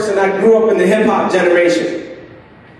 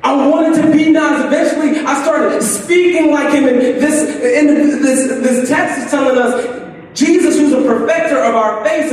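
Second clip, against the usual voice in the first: fervent preaching; 4 semitones higher than usual. Acted. Preaching climax, raised voice.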